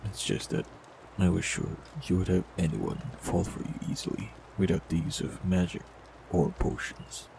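ordinary talk

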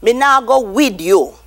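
"with" said as "wid"; a Creole sentence with "with" as "wid". Shown as a demonstration. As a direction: swap the TH in "with" for a D. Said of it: "T H is often replaced with the D".

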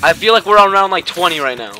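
normal talking voice

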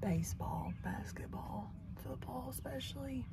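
hushed unvoiced complaint; whispering. Real, not acted. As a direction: whisper it so he won't hear. Complains about husband's game.

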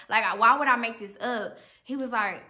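ordinary talk, nothing marked

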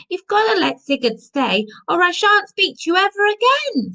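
high, loud cross demand; scowling child. A narrator's reading. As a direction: threaten like a sulky boy. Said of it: "scowled Tim".

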